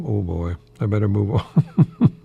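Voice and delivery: ordinary delivery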